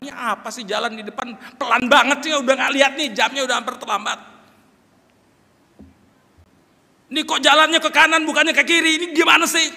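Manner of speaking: teriakan marah yang jauh lebih tinggi dan keras; pengemudi kesal. Retold or imitated. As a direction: bentak jalanan yang lambat dengan kesal. Pengemudi marah di jalan ke gereja. (teriakan kesal sopir yang terlambat)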